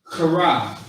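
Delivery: "kara" with the k scraped hard in the throat; forceful throaty K. Shown as a demonstration. Teacher contrasting Hebrew words, "kara" forced and throaty. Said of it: "you're saying it with force".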